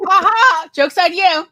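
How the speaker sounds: loud teasing ha ha; mocking gloat. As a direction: gloat loudly at the joke. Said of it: "Tom's like".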